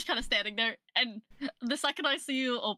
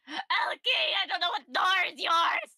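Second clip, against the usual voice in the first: high yelling call; screaming; 7 semitones higher, slower than usual. Acted. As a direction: scream the name out. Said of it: "I'm just gonna scream".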